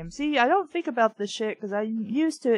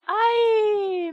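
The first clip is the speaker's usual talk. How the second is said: high shout of fright; sudden loud yelp, higher and louder; girl yelps, thinking a spider's on her; startled shriek; 11 semitones higher, 6 dB louder than usual. A narrator's reading.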